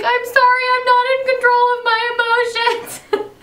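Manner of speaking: high, loud sing-song apology through a laugh; mock teary apology. Retelling her crying at the restaurant, high laughing sing-song. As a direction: wail it half-laughing, half-singing. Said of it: "I was like".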